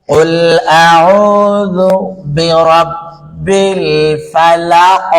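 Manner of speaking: slow drawn-out recitation; Quran recitation. Shown as a demonstration. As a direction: chant slowly, holding the long vowels. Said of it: "recites verse for class".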